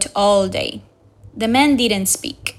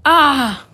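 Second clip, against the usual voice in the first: a short startled "ah", louder and higher; sudden cry; 3 semitones higher, 5 dB louder than usual. A narrator's reading. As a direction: gasp out in shock. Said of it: "Captain Ahab suddenly cried".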